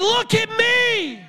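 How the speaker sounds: high, slow, ringing shout; Jesus speaking through Peter; voice of Jesus commanding. Acted. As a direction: proclaim with divine authority. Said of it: "the Jesus inside of Peter said".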